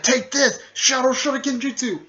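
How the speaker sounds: high, loud attack call; battle cry. Retold or imitated. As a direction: call out the jutsu like an anime fighter. Acted attack call in fight.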